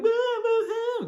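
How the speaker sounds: high, loud fake crying; mocking someone who is crying over an ex, hammed-up wail; mock boo-hoo wail. Mocking a heartbroken partygoer, exaggerated boo-hooing.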